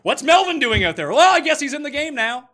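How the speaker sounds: loud rant. Real, not acted. Cohost mocking the coaches' decision, loud rant. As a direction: keep hammering the point, loud. Cohost still ranting loudly.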